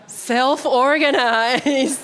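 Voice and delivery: laughing through the word; slow, amused, laughing. Real, not acted. Facilitator teasing the silent room.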